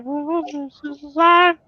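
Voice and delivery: put-on high-pitched feeble old man voice; Herbert from Family Guy. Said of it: "pretend to be, like, Herbert from Family Guy".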